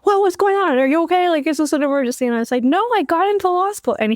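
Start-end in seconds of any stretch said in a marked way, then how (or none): 0.0-2.3 a worried father bursting in from the next room; dad hearing a scream, asking if she's okay; alarmed dad. Retold or imitated. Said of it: "he was just like". 2.6-4.0 joyful. Retold or imitated. Retold joyful reply to dad.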